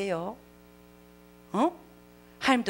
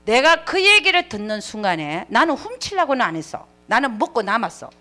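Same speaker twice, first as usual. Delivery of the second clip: normal talking voice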